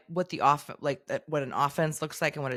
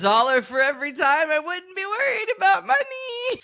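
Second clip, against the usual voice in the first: rising higher and higher to a sustained squeal; mock exasperated wail; 10 semitones higher, 4 dB louder than usual. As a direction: climb steadily into a high, held mock wail. Exasperated retort to co-host's teasing.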